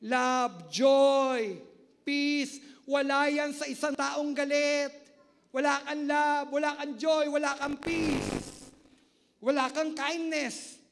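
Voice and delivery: loud fervent sermon, listing the fruits; full-force preaching. Acted. Pastor rousing the congregation about the Spirit, loud preaching. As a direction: proclaim it to the congregation.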